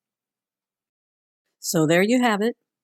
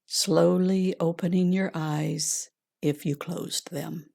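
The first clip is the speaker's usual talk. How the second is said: calm grounding exercise; 3 dB quieter than usual. Acted. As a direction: gently guide them inward, unhurried. Leads a heart meditation.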